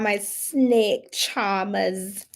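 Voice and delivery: slow put-on accent dropping the R; drawn-out "charmers" in a badly faked accent; hammy fake accent, very slow; terrible accent. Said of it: "Terrible accent, but it's fine".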